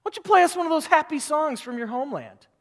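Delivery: loud sneering demand for a song; mocking captors. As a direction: taunt the exiles to sing. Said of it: "the people there were mocking them".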